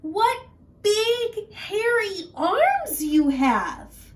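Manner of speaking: high, slow, loud wonder; wary girl noticing; little girl wondering. Acted. Little Red voiced.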